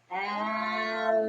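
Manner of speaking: "Alligator" stretched out syllable by syllable on a level pitch; very slow syllables. Shown as a demonstration. Teacher asking child to count syllables, "Alligator" very slowly drawn out. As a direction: draw "Alligator" right out, syllables still slightly joined. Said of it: "Say it with me slowly".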